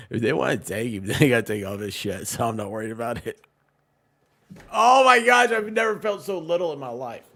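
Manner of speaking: ordinary delivery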